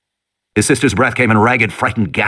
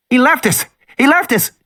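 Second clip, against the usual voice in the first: put-on panicked girl; 13 semitones higher than usual. Lex panicking in car.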